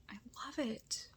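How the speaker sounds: breathy secret whisper of love for a film. Real, not acted. Whispering adoringly to viewers about the movie. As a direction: whisper it like a confession. Gushing over favorite movie.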